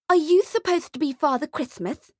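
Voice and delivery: put-on high, challenging child voice; high-pitched bratty kid; bratty little girl. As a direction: question him like a suspicious brat. Hostile little girl confronts mall Santa.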